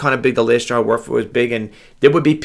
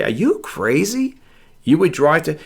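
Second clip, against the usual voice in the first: incredulous, higher-pitched drawn-out question; imitated disbelief; 4 semitones higher, slower than usual. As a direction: mimic people who think you're nuts. People questioning his driving in blizzards.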